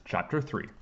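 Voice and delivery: usual speaking voice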